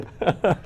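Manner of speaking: short high laugh; quick rueful laugh; laughter. Real, not acted. Laughs at never being invited.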